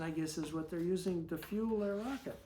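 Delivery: plain reading voice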